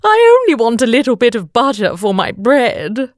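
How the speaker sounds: high, plaintive king's complaint; hammed-up royal self-pity; mock whimper. A narrator's reading. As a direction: whine it high, quickening toward the end. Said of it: "he whimpered".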